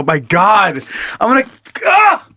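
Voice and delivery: climbing to a high cry; strained, rising yelp of disgust; grossed-out outburst. Real, not acted. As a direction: break off into a high wail. Fake retching outburst at gross talk.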